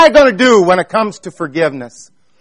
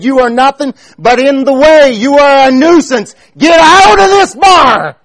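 loud, rising angry bellow, higher and louder toward the end; retold bellow; 7 semitones higher, 5 dB louder than usual. Farmer bellowing at the preacher to leave the barn, angry shout. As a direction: bellow it like a furious farmer ordering you out. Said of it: "he bellowed out against me".

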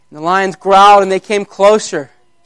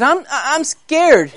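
put-on high, stammering baby-lion voice; scared little lion; 7 semitones higher, slower than usual. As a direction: squeak it out like a frightened cub. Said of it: "This sounded like a little one".

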